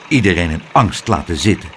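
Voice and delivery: low, fast grumble; growling. A narrator's reading. The weatherman grumbling at the naughty boy, low and fast. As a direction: mutter it down low and cross. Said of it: "gromde hij verder".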